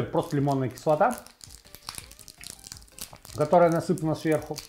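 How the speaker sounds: usual speaking voice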